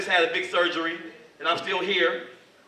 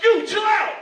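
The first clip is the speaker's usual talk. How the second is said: loud, high order to stop; acted warning shout; 14 semitones higher, 8 dB louder, slower than usual. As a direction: shout at him to back off. Shouting at the man choking her.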